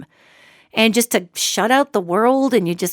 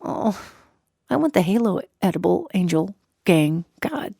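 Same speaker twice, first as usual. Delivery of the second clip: much softer, ending in a whisper; whispered longing; 2 semitones lower, 4 dB quieter than usual. Pretend. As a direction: sigh it out to yourself. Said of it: "I'm thinking".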